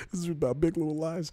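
high, strained choked-up voice. Real, not acted. Podcast host introducing Big Little Lies story, choked up. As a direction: squeeze the words out high, choked up. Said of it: "I'm getting a little choked up".

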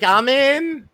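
keen and high, all in; eager. Retold or imitated. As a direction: jump at the offer. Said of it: "I'm like".

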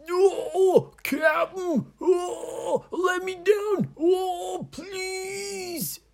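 high, wailing cry for help; hammed-up agonised wail, very high; pained pleading. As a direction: beg to be let down as if being crushed. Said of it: "they sounded like they were in pain".

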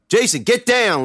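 loud, high warning shout; yell. A narrator's reading. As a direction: yell a command across the river. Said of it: "Lee yelled".

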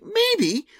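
MAYBE sent way up high, doubtfully; high-pitched maybe. Shown as a demonstration. Partner dodging a steep hike, high-pitched MAYBE. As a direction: let MAYBE climb high so it means no. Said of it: "when it goes high like that".

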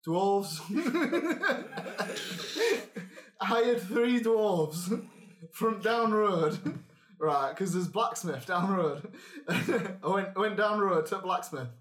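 laughing through the Northern innkeeper voice; northern accent. Acted. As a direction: GM as barkeep, telling the players how the dwarves got him in. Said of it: "That's how they talk".

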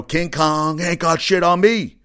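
high, slow mock bellow; cocky boast. Host acts out young man feeling indestructible, cocky drawn-out boast. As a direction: draw it out like an invincible braggart.

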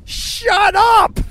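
high, loud mock outrage; outraged yell. Host answering the cereal industry's slogan, exasperated shout. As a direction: yell it at the advertisers.

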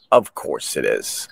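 low solemn aside; mock deep answer. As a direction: drop into a deep mock-serious voice. Answering own question, deep voice.

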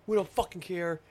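mock hostile brush-off; retold dismissive snap. Soldiers brush off the recon team's excuse, mock curt anger.

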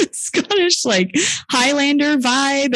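high voice lifted by a laugh, slowing down; amused, laughing. Real, not acted. Storyteller recounting a stranger's odd film pitch, amused and laughing. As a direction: say it through amusement, drawn out.

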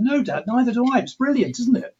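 high-pitched excited agreement; a child answering his laughing father in high excitement; delighted reply. Retold or imitated. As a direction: tell Dad, thrilled, that it's brilliant.

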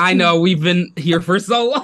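loud laughing agreement. Real, not acted. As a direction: laugh while you agree.

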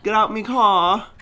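high, loud, slow mock kid shout; a hint of a bossy little girl yelling; meme girl voice. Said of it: "was the girl who goes".

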